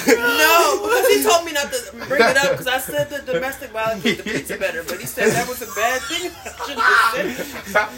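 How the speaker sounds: loud, laugh-choked explanation; protesting through real giggles; laughing confession. Real, not acted. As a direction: explain yourself while cracking up. Defends own joke while laughing.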